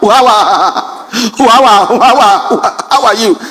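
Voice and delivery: stuck, repeated stammer on "how are"; stammering friend. Retold or imitated. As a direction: struggle through the greeting, restarting it. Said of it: "My friend happened to be a stammerer".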